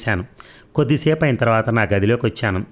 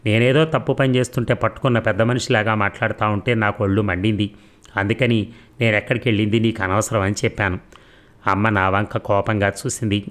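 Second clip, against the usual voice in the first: ordinary delivery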